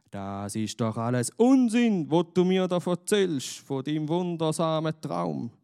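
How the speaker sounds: high, loud angry scolding. A narrator's reading. Angry master scolding the shepherd boy, loud and high. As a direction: scold him hard, voice up and loud. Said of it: "schimpft der Abton".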